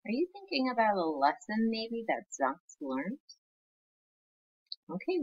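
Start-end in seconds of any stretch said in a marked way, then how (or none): none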